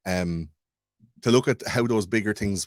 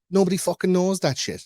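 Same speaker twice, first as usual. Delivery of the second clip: ordinary talk, nothing marked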